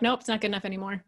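fast, brushing it off; quick dismissal. Retold or imitated. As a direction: rattle it off dismissively. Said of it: "the next day you're like".

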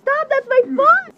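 high, urgent outcry; squealed, indignant objection; protesting yelp. Real, not acted. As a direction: yelp to stop them. Objects as foot is grabbed.

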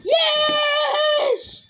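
loud delighted yell; excited cheer. Real, not acted. Kid cheering as the show result comes in, loud happy yell. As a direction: whoop with joy at the win.

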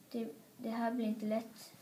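ordinary delivery, nothing marked

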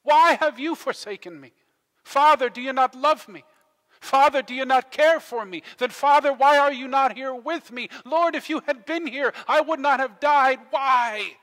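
full-force shouted lament; anguished crying out. Acted. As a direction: cry out as one abandoned and dying. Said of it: "Jesus cried out".

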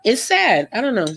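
ordinary talk

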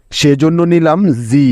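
ordinary delivery

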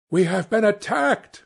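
high, loud alarmed scream. A narrator's reading. Manager shouting to the man ashore, alarmed scream. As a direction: cry out in panic. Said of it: "screamed the manager".

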